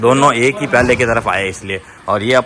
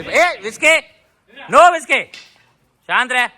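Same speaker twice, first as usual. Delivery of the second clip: high sharp shout; 13 semitones higher than usual. Real, not acted. Owner shouting high at his dog to stay calm. Scolding dog Whiskey mid-fight.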